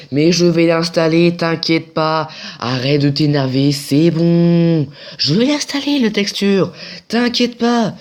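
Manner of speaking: hammed-up tantrum, climbing into high-pitched shouting; mock-exasperated yelling that ends in a squeal; mock exasperation. Mock argument with app over installing textures.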